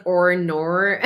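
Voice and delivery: imitating a friend's Aussie "or nor"; "nor" with an exaggerated Aussie R ending; mock Aussie accent. Teasing Aussie friend's way of saying it, mock Aussie accent. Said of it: "he says things like".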